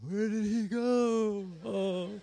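high, slow, drawn-out confusion; hammed-up puzzled Abraham, stretching the words; bewildered old father. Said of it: "Abraham, like".

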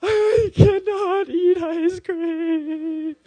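loud, high-pitched wail, words drawn out; mock weeping. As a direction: imitate a child's tearful meltdown, hammed up. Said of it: "starts weeping. And he goes".

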